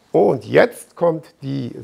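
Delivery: ordinary talk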